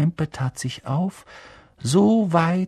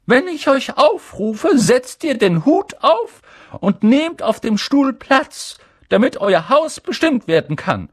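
higher, louder strict teacher voice; McGonagall addressing pupils; 13 semitones higher, 9 dB louder than usual. A narrator's reading. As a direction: call it out across the hall like a strict professor. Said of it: "sagte sie".

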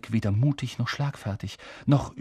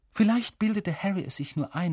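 plain reading voice

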